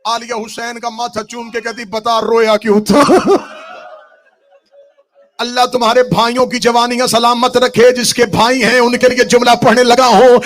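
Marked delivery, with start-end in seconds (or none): 1.8-10.4 preaching at full force. Acted. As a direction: build to a grieving shout. Zakir raises majlis to full force. (پوری قوت سے مجلس کا بین)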